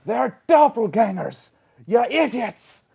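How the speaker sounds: high, loud outcry from a fallen man; Elric. Acted. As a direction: shout the warning, exasperated. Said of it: "Elric goes".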